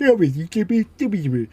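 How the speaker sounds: swallowed-syllable gibberish; mushy machine babble; garbled smart speaker. Retold or imitated. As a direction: imitate a gadget clipping every word. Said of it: "start and finish of every word isn't there".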